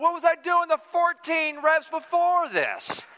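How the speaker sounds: high, slow, strained complaint; mock exasperation. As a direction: wail it like an exhausted lifter protesting. Exasperated reply while straining.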